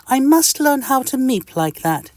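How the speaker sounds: high, cheery tiny-creature greeting; friendly little itch. Acted. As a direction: greet your neighbour brightly and squeakily. Mister Itch voice.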